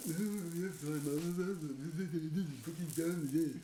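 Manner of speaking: lightly put-on Boris voice with hesitant ums; a hint of a posh politician dodging; Boris Johnson impression. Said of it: "He did that really well".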